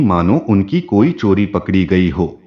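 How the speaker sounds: usual speaking voice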